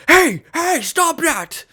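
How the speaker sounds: high, loud alarmed shouting; security guard shout. Retold or imitated. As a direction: yell out to stop someone immediately. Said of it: "the grocery store security was like".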